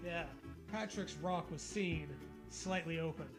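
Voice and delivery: normal talking voice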